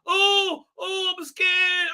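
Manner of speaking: high, drawn-out mocking wail; mock scared. Reviewer mocking Doctor Who for being scared, high hammed-up fright. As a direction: mimic her fear in a slow high whine. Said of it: "this thing that you're going".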